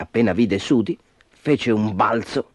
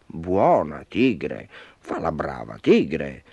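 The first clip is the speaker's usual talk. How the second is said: slow, low coaxing; calm it down with long, low strokes of the voice; soothing, petting; 5 semitones lower, slower than usual. A narrator's reading. Sudi stroking the angry tiger, soothing. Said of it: "le disse Sudi accarezzandola".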